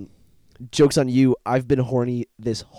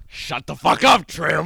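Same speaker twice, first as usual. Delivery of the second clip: loud sneering high bully; 6 semitones higher, 7 dB louder, slower than usual. Acted. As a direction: taunt the weak kid, slow and loud. Bully voiced in skit.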